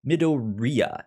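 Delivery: usual speaking voice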